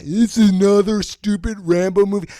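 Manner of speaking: a hint of a sneering high doubter; lightly voiced mocking critic.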